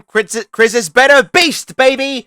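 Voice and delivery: high, loud triumphant boast; excited hype. Real, not acted. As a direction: sell it like a hype man. GM hypes homebrew monster.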